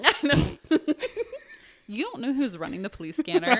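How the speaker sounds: high laughing words. Real, not acted. Still laughing at the noise.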